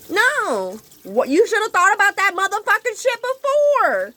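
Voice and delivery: loud, high shout of fury; furious yell. Retold or imitated. Yelling at her partner for not thinking ahead, loud and furious. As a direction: scream it out in rage.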